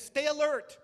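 preacher rousing the congregation with a loud warning; urgent warning. Acted. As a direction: stay alert, called out high and loud. Preacher quotes Peter's warning.

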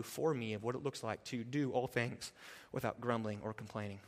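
usual speaking voice

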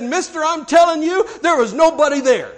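high, loud, astonished insistence; emphatic insistence. Retold or imitated. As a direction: insist loudly, pitched well up. Biker insists to the preacher.

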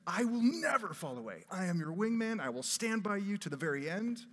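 brash self-confidence. Retold or imitated. Peter boasting of his loyalty to Jesus, loud and cocky. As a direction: play the cocky loyal wingman. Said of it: "full of a sense of self-confidence".

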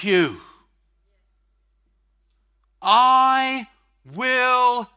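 booming preacher stretching every word; full-force preaching. Acted. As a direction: proclaim the promise to the whole hall.

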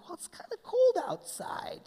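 very high, airy little wimp voice; mock reluctant whiner. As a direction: squeak out a feeble excuse, mocking. Mocks a reluctant listener's excuse.

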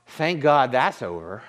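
mock relieved sigh, pitched low; low, sarcastic thanks, acted out; sarcastic relief. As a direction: imitate someone glad a chore is over. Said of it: "they kinda say sarcastically".

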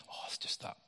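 whispered aside, brushing him off; hushed crowd muttering he's nothing; dismissive whisper. Retold or imitated. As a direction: whisper it as if he's not worth noticing. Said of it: "the people around them going".

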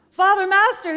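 high, loud alarmed call; shocked shout to fetch the priest; horrified cry. A narrator's reading. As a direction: cry out for help. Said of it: "he cried, rushing off".

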